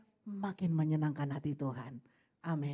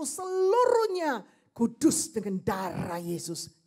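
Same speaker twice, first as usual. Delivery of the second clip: loud, raised, insistent pleading; fervent prayer; 5 semitones higher, 9 dB louder than usual. Acted. Believer begging God to sanctify him, fervent loud prayer. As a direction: cry out each petition at full force.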